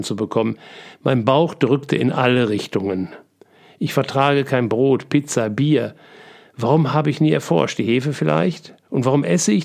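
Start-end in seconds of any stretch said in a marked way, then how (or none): none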